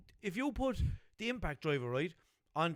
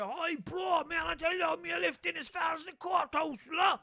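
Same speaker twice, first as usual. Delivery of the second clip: put-on Cork accent, much higher and shouted; 12 semitones higher, slower than usual. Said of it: "That was a very good Cork accent".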